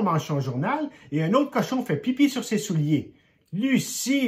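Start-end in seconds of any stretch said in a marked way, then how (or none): 3.5-4.3 an angry father calls his daughter; calling out. A narrator's reading. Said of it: "lui crie son père". (appel plus fort et aigu du père)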